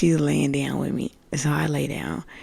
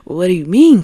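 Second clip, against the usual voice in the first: louder, higher indignant retort; incredulous protest; 4 semitones higher, 12 dB louder than usual. Retold or imitated. Woman answering the voices telling her to calm down, indignant outburst. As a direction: burst out in disbelief, louder.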